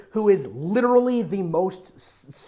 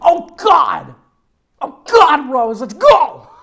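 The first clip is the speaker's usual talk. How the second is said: loud, high melodramatic yell; over-the-top cry to drag a friend along; hammed-up cry; 8 semitones higher, 11 dB louder, slower than usual. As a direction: belt it out, stretching the last word. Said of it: "Madison cried".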